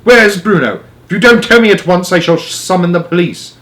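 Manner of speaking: loud, high furious threats. Acted. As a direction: threaten her at full volume. Jenkins threatens police.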